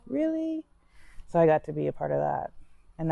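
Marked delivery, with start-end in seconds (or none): 0.1-0.6 high, amazed "Really?"; incredulous retold reaction; surprised. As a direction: caught off guard, pitched way up. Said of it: "I'm like".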